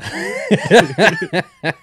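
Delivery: high, loud burst of laughter. Real, not acted. Laughing at the friend's Florida joke, loud laugh. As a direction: crack up loudly.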